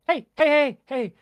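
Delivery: high, loud ice fairy calling out. Acted. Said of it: "a very soft voice in the sides".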